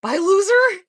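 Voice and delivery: high, loud taunting "Bye, loser"; mocking quote. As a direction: throw the insult back, high and loud. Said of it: "And then P says".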